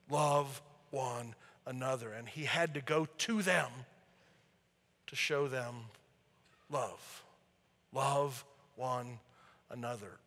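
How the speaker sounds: hushed. Acted. Preacher confiding the heart of the gospel, hushed and slow. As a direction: drop almost to a hush for the key line.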